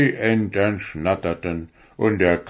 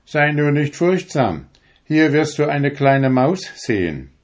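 reassuring Sandman; 6 semitones higher, 3 dB louder than usual. A narrator's reading. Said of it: "sagte der Sandmann".

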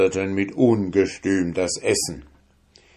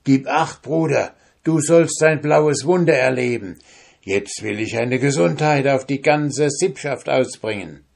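sly soldier; 6 semitones higher than usual. Acted. Soldier plots a trick.

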